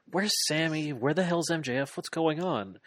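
flustered Jericho. Retold or imitated.

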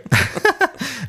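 high giggle. Real, not acted. Laughs at own joke.

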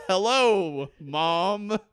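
loud, high little voice calling hello; a tiny fetus yelling hello to mom; loud fetus greeting. Pretend. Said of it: "and it says".

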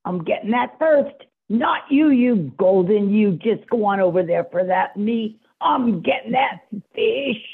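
ordinary talk, nothing marked

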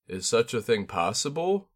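plain reading voice

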